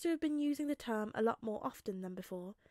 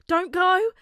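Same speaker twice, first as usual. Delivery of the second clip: put-on high, loud child's cry of don't go; desperate yell; 9 semitones higher, 14 dB louder than usual. A narrator's reading. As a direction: shout it as he bursts in, terrified. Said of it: "The boy yells".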